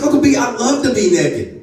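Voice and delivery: put-on high, gleeful child voice; six-year-old niece. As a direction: declare it like a little girl, high-pitched. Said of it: "She said".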